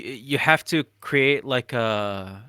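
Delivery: normal talking voice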